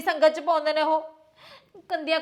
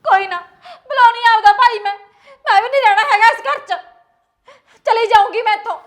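loud, higher-pitched threats in a quarrel; heated, loud outburst; angry shouting; 5 semitones higher, 9 dB louder than usual. Acted. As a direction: shout it like you're storming out. Wife shouts threats in acted quarrel.

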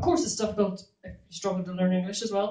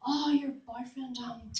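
naive friend; 5 semitones higher than usual. Retold or imitated. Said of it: "They say things like".

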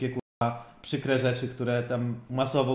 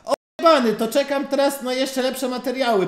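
mock excited fan; 11 semitones higher, 6 dB louder than usual. Mocking Olejnik's gushing message.

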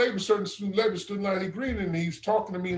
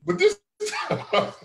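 laughing; 13 semitones higher than usual. Real, not acted. Telling a brother about the minister, laughing. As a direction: break into high laughter while talking.

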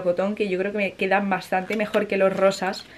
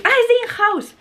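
high, louder cry of delight; excited exclaim; 14 semitones higher, 4 dB louder than usual. Real, not acted. Finding the agency's name on the package, she cries out happily. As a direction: exclaim with genuine joy. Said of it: "Me hace mucha ilusión".

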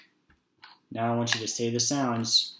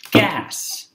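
big bright reveal, much higher and louder; broad singsong kids theatre; 9 semitones higher, 11 dB louder than usual. Shown as a demonstration. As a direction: announce the word like a surprise. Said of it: "It makes the word".

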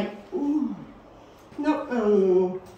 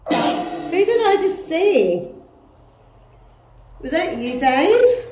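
loud, high call to an unseen presence; raised, searching call; 9 semitones higher, 11 dB louder, slower than usual. Real, not acted. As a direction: project the question, lifting at the end. Calling out to named spirit.

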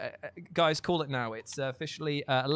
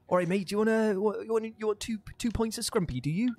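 a hint of broad Devon pub voice, a bit higher; rustic pub local, lightly done; Devon accent; 7 semitones higher than usual. Pub owner offering scrumpy, light Devon accent. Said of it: "it's like".

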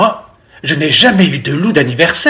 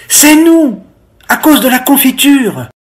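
high, louder piggy outburst; eager confession; 8 semitones higher, 4 dB louder than usual. A narrator's reading. Pig explaining the bath to mother, eager. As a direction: blurt out the excuse. Pig hastily covers for the wolf.

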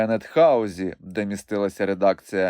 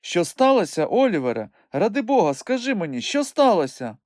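high, urgent woman's voice; alarmed pleading; 9 semitones higher than usual. A narrator's reading. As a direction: beg anxiously, pitched well up. Alarmed, begging to know what happened.

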